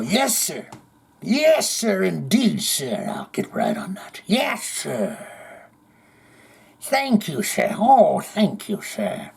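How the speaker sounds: high, fawning mock gratitude dropping to a whisper; mock obsequious servant. Host mocking two-buck song requests, sarcastically servile. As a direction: grovel like an overeager servant.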